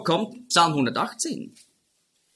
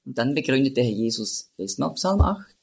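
ordinary delivery

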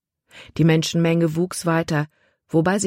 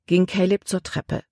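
ordinary delivery, nothing marked

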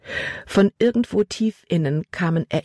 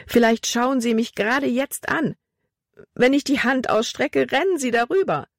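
high, rising panic; panicky; 7 semitones higher than usual. A narrator's reading. Trapped girl imagining mice, high panicky. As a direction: voice climbing in fear. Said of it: "von Panik überwältigt".

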